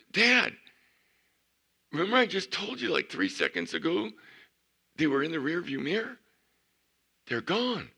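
high, loud and breathless with shock; shocked retelling. Retold or imitated. Daughter telling dad the men vanished, high and shocked. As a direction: blurt it out, stunned.

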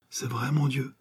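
whispered in fear; a frightened mother's whisper. A narrator's reading. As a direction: breathe it out, scared for her son. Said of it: "effrayée".

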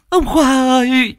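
high, slow, loud anguished wail; loud wailing cry. Acted. Grieving widow screaming for her dead husband. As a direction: let the grief burst out.

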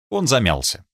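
plain reading voice

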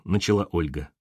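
ordinary delivery, nothing marked

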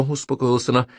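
ordinary delivery, nothing marked

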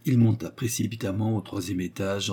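usual speaking voice

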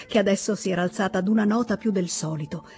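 ordinary delivery

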